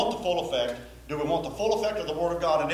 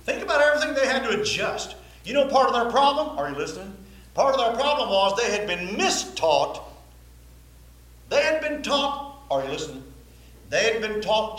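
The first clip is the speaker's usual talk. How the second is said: loud, higher preaching push; full-force preaching; 6 semitones higher, 5 dB louder than usual. Real, not acted. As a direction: drive the point home from the pulpit.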